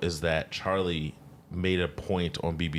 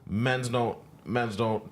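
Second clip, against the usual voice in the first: mock London accent; 4 semitones higher, slower than usual. Mocking [name]'s London lyric.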